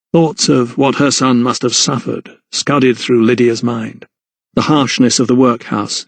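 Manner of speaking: ordinary talk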